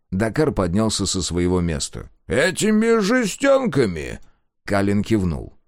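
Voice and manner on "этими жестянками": high, slow scornful disbelief. A narrator's reading. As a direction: sneer at the tin cans. Mocking question.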